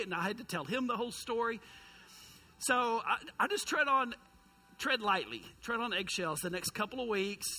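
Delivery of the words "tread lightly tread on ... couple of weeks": normal talking voice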